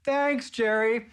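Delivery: high, slow, drawn-out thanks; casting director brushing off Jerry, mock sweet; mock chummy thanks. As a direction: sing out a fake-friendly dismissal. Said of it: "door closes to laughter".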